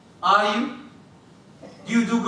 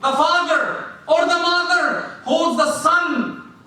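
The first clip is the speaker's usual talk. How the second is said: ordinary talk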